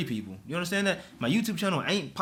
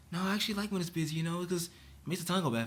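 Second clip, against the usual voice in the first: soft coworker; 2 semitones higher, 8 dB quieter than usual. Retold or imitated. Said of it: "she's in there".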